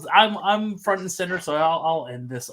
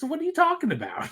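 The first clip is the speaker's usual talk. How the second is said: incredulous, pitched way up; mock disbelief; 11 semitones higher, faster than usual. Real, not acted. Podcast host scoffing at a co-host's joke, pitched-up disbelief. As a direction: act baffled at the claim.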